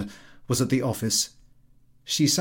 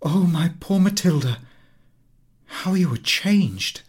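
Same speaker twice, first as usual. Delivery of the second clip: shocked, higher exclamation; cry of surprise; 7 semitones higher, 4 dB louder than usual. Acted. Friend shocked at Mathilde's changed looks, higher crying out. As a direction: gasp it out in dismay. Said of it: "Her friend uttered a cry".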